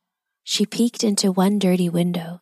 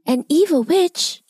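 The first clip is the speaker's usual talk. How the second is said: high, worried inner voice; alarmed thought; 9 semitones higher than usual. A narrator's reading. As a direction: think aloud in dismay. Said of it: "thought Elsie".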